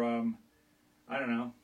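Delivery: normal talking voice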